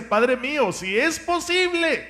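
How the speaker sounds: high, slow plea to the Father; pleading prayer. Acted. Preacher voicing Jesus praying to the Father, pleading.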